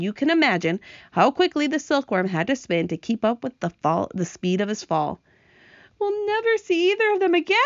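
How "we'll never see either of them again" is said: high, despairing wail in story voice; distressed cry. Acted. Ladybug mourns her lost friends, high wailing cry. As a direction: lament it on a high, held pitch. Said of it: "cried the ladybug".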